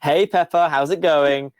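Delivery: friendly surveillance computer fishing for a confession. Acted. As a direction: computer voice in Pepper's ear, lightly done. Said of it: "computer in your ear go".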